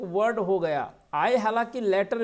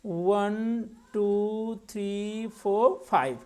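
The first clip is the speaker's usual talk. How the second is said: measured, evenly spaced count; counting syllables; much slower than usual. Shown as a demonstration. Teacher counting a word's syllables aloud, slow and drawn out. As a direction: count out slowly, one drawn-out beat per number. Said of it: "कितने syllable हैं".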